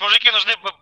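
ordinary talk, nothing marked

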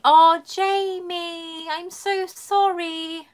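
high, very slow, drawn-out condolence; heartfelt sympathy. Real, not acted. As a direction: stretch it out with tender pity. Condolence for viewer's dead dog.

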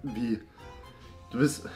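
normal talking voice

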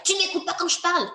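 put-on high, fast, exasperated snap at the child; mother exploding in anger. A mother yelling at her child who won't listen, fast exasperated shout. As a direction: fire it off like a mom at her limit. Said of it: "une maman qui explose de colère".

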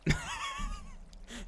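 squeaky laugh. Real, not acted.

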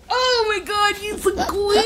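squealing with delight, very high. Real, not acted. Squealing at toddler on potty.

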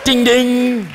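playful fight bell; imitated boxing bell. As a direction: chime ding ding like the opening bell.

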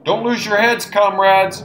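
high, loud rallying shout; rally the comrades while fighting panic. A narrator's reading. Arm shouting to his comrades amid the attack, urgent. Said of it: "shouted Arm".

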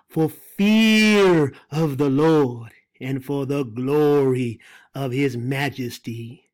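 slow, low, weighty reading of the verse; solemn scripture reading. Acted. Preacher reads Isaiah to congregation, solemn and slow. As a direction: let each word of judgment land heavily.

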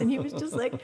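ordinary delivery

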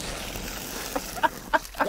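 high unvoiced giggle; breathy laugh. Real, not acted. Laughing at Steve's spam sushi, high breathless giggle. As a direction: laugh at the bad idea.

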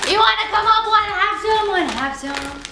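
pet talk voice. Real, not acted. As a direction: coax the dog in a cutesy voice. Talking to the pet.

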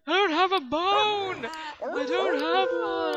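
loud, high, slow plea; shouted protest. Acted. Player shouting back at the game, loud and pleading. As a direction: yell it out as if cornered.

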